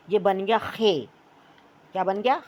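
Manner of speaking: ordinary talk, nothing marked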